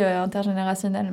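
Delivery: normal talking voice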